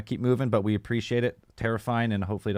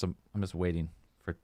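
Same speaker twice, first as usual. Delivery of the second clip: ordinary talk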